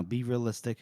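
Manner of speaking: soft, low aside; retold hiss. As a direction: imitate a hushed warning under the breath. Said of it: "Lamb hisses to her".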